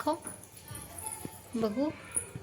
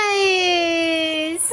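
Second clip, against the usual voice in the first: drawn-out surprise; 4 semitones higher, much slower than usual. Acted. Teasing reveal of tomorrow's surprise.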